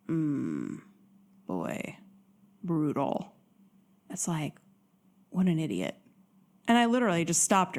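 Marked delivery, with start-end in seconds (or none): 0.1-6.0 1 low muttered disbelief. Real, not acted. Reaction to the date.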